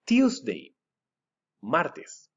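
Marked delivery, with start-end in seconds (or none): 0.1-0.7 "Tuesday" sung out much higher, falling to the end; high teacher model. Shown as a demonstration. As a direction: lift "Tuesday" high for the class to echo. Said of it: "Repeat after me".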